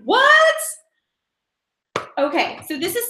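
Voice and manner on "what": high, loud incredulous squeal; laughing, exaggerated shock; mock disbelief. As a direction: shout it way up high, amused. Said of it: "I was like".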